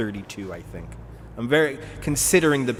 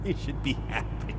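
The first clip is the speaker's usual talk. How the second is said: quieter imitation of his accent; Ron Waxman's accent; 5 dB quieter than usual. Streamer repeating his line after laughing, soft mimicked accent. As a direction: slip lightly into his accent, softly. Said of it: "I'm still doing his accent".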